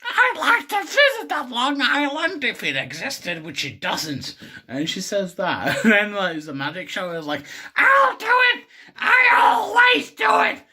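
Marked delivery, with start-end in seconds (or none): 0.0-3.4 squawking cartoon character, pitched way up; high put-on voice. Retelling a reply about Long Island iced tea, high put-on voice. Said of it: "he's just like". 7.7-10.6 shrieking cartoon wife, extremely high and loud; Marge Simpson. Marge volunteers at the magic show, shrieked in falsetto. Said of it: "I'm not doing the Marge".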